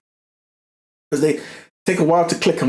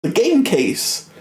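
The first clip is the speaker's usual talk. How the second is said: high, fast, excited realisation; squeaky high excitement, slowing at the end; excited discovery; 12 semitones higher than usual. Retold or imitated. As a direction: blurt it out like you've just cracked the code. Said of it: "and I was like".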